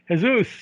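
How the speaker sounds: Jesus said "heh-SOOS"; Jesus stressed on the second syllable, "heh-SOOS"; Spanish pronunciation. Shown as a demonstration. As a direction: give Jesus a Spanish H sound. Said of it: "obviously his first name is Jesus".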